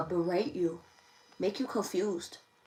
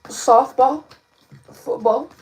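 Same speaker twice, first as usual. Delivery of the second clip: loud call-out; 4 semitones higher, 14 dB louder, much slower than usual. Real, not acted. Calling out reading words.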